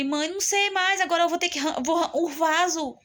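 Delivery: high, strained pleading to mother; desperate plea. Retold or imitated. Daughter at her limit begging her mother, desperate high plea.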